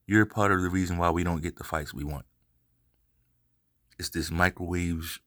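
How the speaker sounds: normal talking voice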